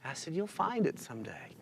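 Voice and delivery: hushed, heartfelt delivery; tender sincerity. Retold or imitated. Widower reassuring waitress about love, tender. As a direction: let it soften almost to nothing, then gently reassure.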